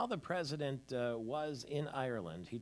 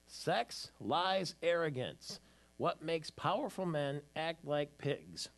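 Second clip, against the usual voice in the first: each word punched, louder, the last line slow; dramatic headline read; 5 semitones higher, 5 dB louder, much slower than usual. Shown as a demonstration. Host reading headline to listeners, slow, punchy and louder. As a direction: read the cover like a tabloid announcer. Reads magazine headline aloud on air.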